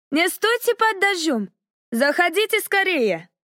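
high, bustling Irish woman; high-pitched Irish housewife, urging; Irish accent. A narrator's reading. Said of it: "говорила с выраженным ирландским акцентом".

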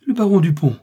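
s'exclamer haut et fort; reconnaître soudain l'intrus, voix qui bondit; exclamation. A narrator's reading. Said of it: "fit la chiromancienne". (cri de surprise)

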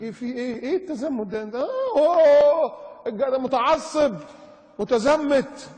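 high, loud hammed-up outcry; mock scandalised. Preacher mimics critics of the devout, mock scandalised.

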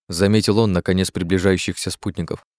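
plain reading voice

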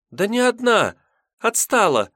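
put-on light, high young maiden; high girl voice. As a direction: answer like a lively young girl. Maiden explains she fell behind.

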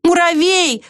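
high, drawn-out calling cry. A narrator's reading. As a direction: hold the last syllable high, calling. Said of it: "снова закричала белка".

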